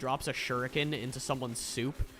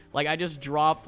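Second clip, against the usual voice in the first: ordinary delivery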